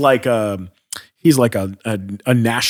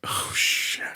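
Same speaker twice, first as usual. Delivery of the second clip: whispered dread; 10 dB quieter than usual. Retold or imitated. Whispered dread on being summoned.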